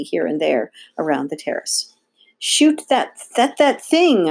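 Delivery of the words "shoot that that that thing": high, forceful order, stumbling for the word; sputtering high-pitched order; urgent command. A narrator's reading. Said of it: "Olympia commanded".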